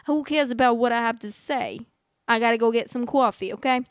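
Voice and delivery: loud, put-on New York accent. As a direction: play a pushy New Yorker with the accent. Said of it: "what kind of accent is that? Like, New York?".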